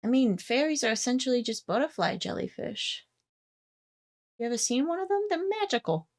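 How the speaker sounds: high, soft character voice; Stanley. Acted. Game master as Stanley answering about fairies, high soft voice. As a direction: pitch it high and keep it soft, rising toward the end. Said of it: "He kinda blinks and, uh, he's like".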